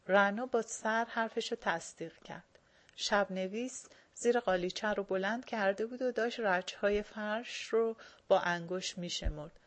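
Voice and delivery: ordinary delivery, nothing marked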